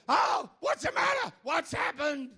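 high, shouted mock alarm; imitating a startled wife waking up. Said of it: "and she goes".

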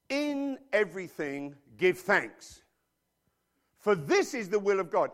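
slow, loud solemn scripture reading. Acted. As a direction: declaim it like holy writ. Quoting scripture.

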